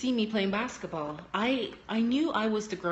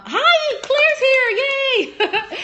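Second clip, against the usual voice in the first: high, loud real squeal of welcome; excited cheer; 10 dB louder, much slower than usual. Real, not acted. Speaker greeting Claire as she turns up, squealing with excitement. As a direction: cheer the arrival, pitch shooting up. Greeting someone who just arrived.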